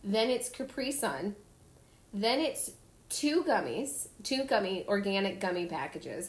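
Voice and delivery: ordinary talk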